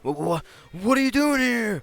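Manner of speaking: stammering, slow, high alarm; startled. Acted. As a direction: stammer in shock. Startled protagonist.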